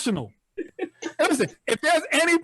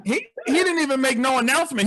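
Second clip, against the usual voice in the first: ordinary talk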